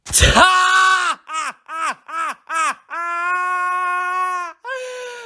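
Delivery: high staccato fake laugh ending in a long held note; exaggerated, drawn-out cackle of ridicule; mocking laugh. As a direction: laugh in rapid high bursts, then hold it. Mocking the idea of reading books.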